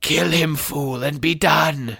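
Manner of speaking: slow, high, raspy screech; hissing villain's impatient order. A narrator's reading. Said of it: "screeched Voldemort".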